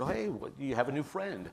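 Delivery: mock-excited greeting to a child. A parent at aftercare greets his son's new friend, excitedly. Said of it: "and I was very excited".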